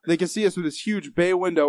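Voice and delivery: usual speaking voice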